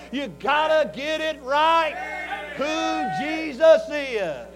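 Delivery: slow, driven sermon emphasis; preaching. Acted. Preacher urging congregation, slow emphatic preaching. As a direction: press the point home.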